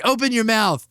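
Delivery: high-pitched mock command; old Sicilian lady. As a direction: order it like a no-nonsense matron. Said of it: "and she's like".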